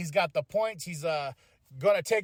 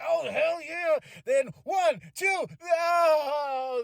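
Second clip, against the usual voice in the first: louder, higher cheering at the count; retold cheering; 7 semitones higher, 3 dB louder, much slower than usual. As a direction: count along like a hyped fan. Reliving near-fall excitement.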